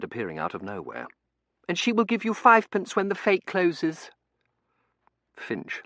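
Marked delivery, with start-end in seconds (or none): 1.7-4.1 put-on high, loud child voice; piercingly high imperious little girl; demanding little girl. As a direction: announce it like a bossy child.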